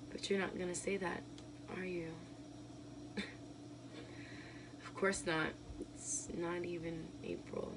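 soft, fast, defeated; deflated and quiet. Acted. To her husband after the outburst, soft and defeated.

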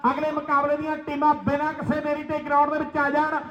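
usual speaking voice

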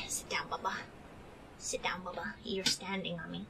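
hushed whisper to the dog. Real, not acted. Telling the dog on her chest to sit, whispered.

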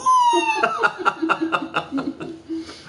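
squealing laugh; helpless shrieking laugh; high shriek of laughter. Real, not acted. Laughing at film quote.

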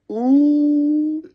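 high drawn-out teasing ooh; high mock ooh. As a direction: tease with a high, long ooh. Reacting to in-law joke.

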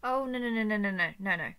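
loud run of noes, sliding down in pitch; panicked denial. Acted. Captor fearing she misjudged her love, panicked denial. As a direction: spill out the noes in alarm. Dawning panic at being misunderstood.

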